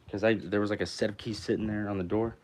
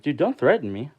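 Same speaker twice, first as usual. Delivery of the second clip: raised, higher-pitched retort; heated warning; 7 semitones higher, 6 dB louder than usual. Retold or imitated. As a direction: warn him off in a retold quarrel. Retold parking quarrel, warning back.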